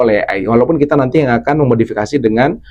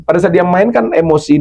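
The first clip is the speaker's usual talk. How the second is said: ordinary delivery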